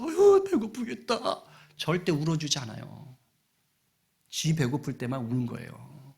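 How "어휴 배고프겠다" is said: high, drawn-out cooing sympathy; hammed-up feeling sorry for someone; mock pity. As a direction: sigh with exaggerated pity, pitched way up. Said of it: "안타까워하면서".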